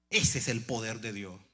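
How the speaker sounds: normal talking voice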